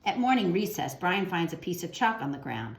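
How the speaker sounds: put-on gentle storybook narration for kids; reading to children. A narrator's reading. Teacher reading a picture book to children, gentle storytelling. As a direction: read the picture book aloud to young listeners.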